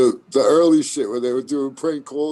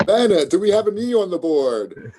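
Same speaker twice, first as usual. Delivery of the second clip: game show host; 5 semitones higher than usual. Acted. Game show player tries out a host's voice, hammed-up game show host.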